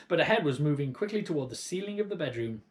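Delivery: plain reading voice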